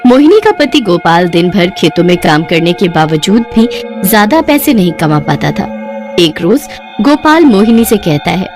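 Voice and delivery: ordinary delivery, nothing marked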